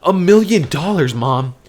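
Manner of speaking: high-pitched, stammering excitement; excited disbelief. Acted. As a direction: break incredible news to mom, stumbling over it. Son breaking money news to mother.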